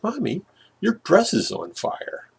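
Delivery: put-on childlike report. Little brother telling mom calmly.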